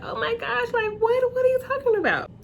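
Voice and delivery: imitating disbelief. A friend learns of the divorce, imitating high shock. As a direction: act out the friend's stunned shock. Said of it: "She was like".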